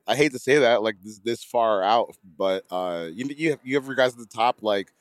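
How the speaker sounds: ordinary talk, nothing marked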